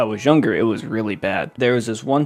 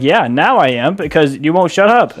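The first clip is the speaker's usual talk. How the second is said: loud, higher snappy reply; fed-up retort; 5 semitones higher, 7 dB louder than usual. Retold or imitated. Kid snapping back at arguing stepdad, loud and higher.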